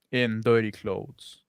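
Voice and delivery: usual speaking voice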